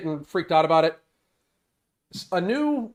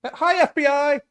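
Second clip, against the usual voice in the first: mock greeting to FBI; 10 semitones higher, 4 dB louder than usual. Joke greeting to listening feds.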